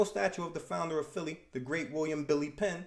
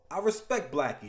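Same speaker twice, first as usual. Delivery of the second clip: usual speaking voice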